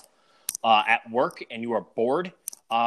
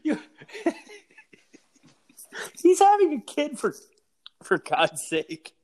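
incredulous rant; 10 semitones higher, 3 dB louder than usual. Real, not acted. Outraged ranting over a birth.

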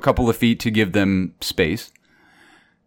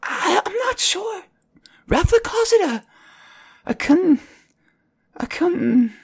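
put-on high, halting little girl searching for a word; a young girl struggling to remember, pitched high; Mira's small child voice. Little Mira, unsure, high and hesitant.

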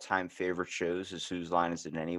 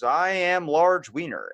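high, loud, slow mock newscaster; news reporter impression; 7 semitones higher, 5 dB louder, slower than usual. Comedy show news report sketch, slow loud mock-reporter voice. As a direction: announce it like a comedy news anchor, stretched out. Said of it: "they're doing the news report".

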